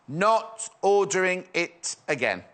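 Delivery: slow, word-by-word reminder; slow, emphatic repeat. Real, not acted. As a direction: spell it out one word at a time. Restates it slowly for emphasis.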